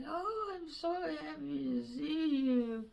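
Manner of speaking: put-on sick old king softly welcoming visitors; frail old king. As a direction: greet them like a sickly old king. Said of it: "And he's like".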